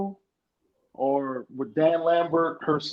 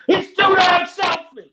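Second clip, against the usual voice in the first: much higher, slow, louder gripe; mock wrestler gripe; 10 semitones higher, 5 dB louder than usual. Cohost voices Sami blaming Kevin Owens. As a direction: spit it out like a sore wrestler.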